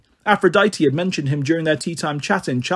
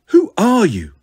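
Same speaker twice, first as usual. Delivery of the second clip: fearful question; 4 semitones higher, 4 dB louder, slower than usual. Acted. Stunned pater asks.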